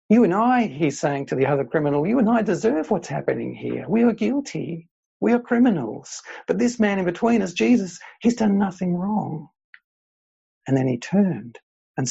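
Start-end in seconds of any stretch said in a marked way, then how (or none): 0.1-0.7 urgent plea. Acted. Preacher voicing the repentant criminal. 2.1-9.5 urgent plea. Acted. Preacher voicing the repentant criminal.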